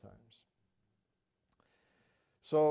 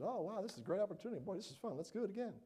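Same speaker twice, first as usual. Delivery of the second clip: higher, fast, cheery mock excitement; sarcastic delight at a grim scene; hammed-up joy, a little higher and quick; mock glee; 6 semitones higher, faster than usual. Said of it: "went over there and said".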